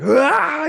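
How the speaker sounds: loud, high, sustained meltdown scream; imitated child's scream. Dad imitating his son's meltdown after the fall, screaming. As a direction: mimic a furious child screaming at full pitch. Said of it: "He's screaming away".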